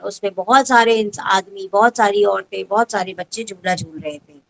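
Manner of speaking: ordinary talk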